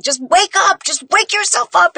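high, strained tearful urging; pleading with yourself. Retold or imitated. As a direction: beg yourself to wake from the dream. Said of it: "have you ever had a dream where you're like".

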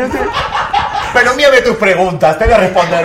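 high, loud words broken by real laughter; can't stop laughing at herself. Real, not acted. Guest laughing at her own blunder with Tenoch, laughing. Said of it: "riéndome".

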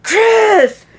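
high, loud call to the neighbor; yelling across the fence. Retold or imitated. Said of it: "So I yell across the fence".

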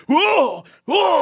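high mock startled whoa; hammed-up dodging cries; exaggerated whoa. Dad dodging carts, high mock startled whoas. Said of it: "he's so overexaggerated".

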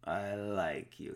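put-on a lighter take on a thick foreign accent; Avi Arad heavy accent. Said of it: "but he's like".

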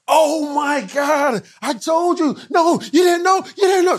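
high, loud gloating yell; the producer shouting triumph when the room explodes; triumphant shout. Retold or imitated. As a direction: crow over winning the bet.

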